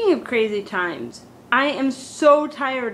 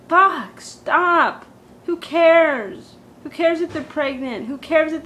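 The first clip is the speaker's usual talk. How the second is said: louder, higher, fed up; exasperated; 5 semitones higher, 4 dB louder, slower than usual. Real, not acted. Tired of celebrity news, exasperated outburst. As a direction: snap it out, sick of the headlines. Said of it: "exasperated outburst".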